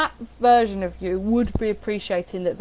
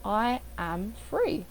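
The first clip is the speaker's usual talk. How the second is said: slow, evenly spaced words, softer; different inflection; 7 dB quieter, much slower than usual. Shown as a demonstration. Coach demonstrating another inflection, slow and measured. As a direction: give "I", "am" and "free" room each. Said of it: "Different inflection".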